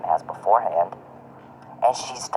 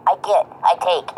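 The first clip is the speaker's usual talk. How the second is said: higher, slow, sneering take on a greedy man; a hint of Charles mocked as greedy; mocking Charles; 8 semitones higher, 3 dB louder than usual. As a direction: mimic him like a spoiled child. Mimicking Charles.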